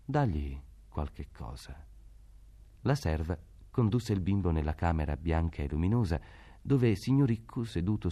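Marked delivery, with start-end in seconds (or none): 0.1-1.9 slow, soft, tired order; a tired man waves it off quietly; weary judge. A narrator's reading. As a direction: judge tells the servant to give something. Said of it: "e disse, guardando Pascaleddu".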